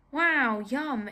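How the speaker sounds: mock delight, high and loud; sarcastic. Mocking her old tiny meal to viewers, sarcastic cheer. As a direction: fake enthusiasm about a sad meal. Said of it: "It was not yum".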